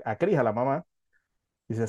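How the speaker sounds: ordinary talk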